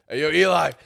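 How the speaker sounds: loud, high, irritated call; mock loud irritated wake-up yell. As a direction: yell his name to stop the snoring. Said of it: "and I hear".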